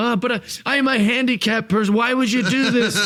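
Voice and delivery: high, fast cowardly pleading; fast, high fake-helpless plea to the wrestlers; cowardly manager. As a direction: whine like a sniveling wrestling manager. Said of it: "I go".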